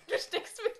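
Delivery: high, loud laugh through words. Real, not acted. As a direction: crack up while talking. Friend's finger stuck, laughing.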